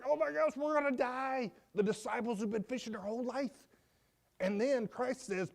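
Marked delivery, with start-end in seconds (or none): 0.0-1.5 high-pitched mock panic; freaking out. Disciples watching waves fill the boat, mock freak-out. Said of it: "They're freaking out".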